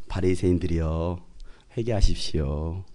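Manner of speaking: very low, soft and slow appeal; hushed, drawn-out, courteous pleading; mock-gentle preacher. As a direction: parody a far too polite call to repent. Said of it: "그렇게 말씀하시지 않았어요".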